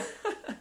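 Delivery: soft, high trailing laugh. Real, not acted. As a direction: giggle on quietly after the joke. Still laughing at his joke.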